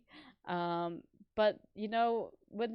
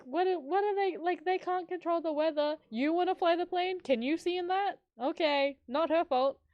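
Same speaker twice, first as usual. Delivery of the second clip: exasperated rant; 9 semitones higher, 4 dB louder than usual. Real, not acted. Ranting at rude customer.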